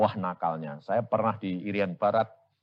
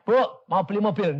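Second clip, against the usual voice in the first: eager asking; 9 semitones higher, 6 dB louder, faster than usual. Acted. Acting the question to widow.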